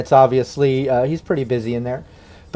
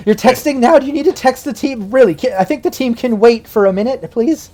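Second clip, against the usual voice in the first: higher, pointed, disbelieving; exasperated scold; 8 semitones higher than usual. Acted. Cypher in labor scolding Rush for texting the team, exasperated and higher. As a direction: scold him for texting mid-birth.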